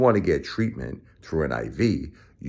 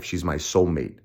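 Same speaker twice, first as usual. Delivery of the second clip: normal talking voice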